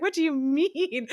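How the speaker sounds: incredulous, laughing it out; retold disbelief. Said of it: "I was like".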